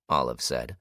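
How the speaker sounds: ordinary delivery, nothing marked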